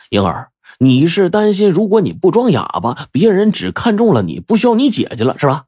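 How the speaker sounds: high-pitched, eager reasoning; animated prince piecing it together; excited deduction. A narrator's reading. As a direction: press the guess home, voice soaring. Prince excitedly works out the motive.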